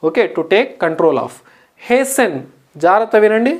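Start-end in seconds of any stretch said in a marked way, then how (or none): 1.9-2.3 HASTEN said as HAY-sen; silent T. Shown as a demonstration. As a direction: drop the T in HASTEN. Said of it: "టీ పలకదండి".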